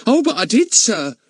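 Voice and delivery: high, chatty housekeeper protesting. Acted. Charwoman contradicting the village policeman, high and eager. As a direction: pitch her up like a bustling charwoman.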